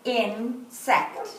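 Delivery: "Insect" broken into In, pause, sect; "Insect" with a clear gap after In; syllable by syllable. Shown as a demonstration. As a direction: count out "Insect" in two separate beats. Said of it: "Let's do insect".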